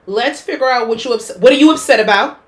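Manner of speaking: loud mock-calm parent asking. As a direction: play the frazzled parent demanding an answer. Mock parent to crying child.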